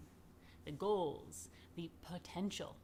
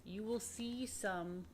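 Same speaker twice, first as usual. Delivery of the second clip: ordinary delivery, nothing marked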